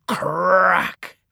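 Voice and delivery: long rolled-out "crack" like breaking rope; imitated snap. A narrator's reading. Narrator tells how the trucks broke the rope, imitating it. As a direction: make "crack" sound like the break, drawn out.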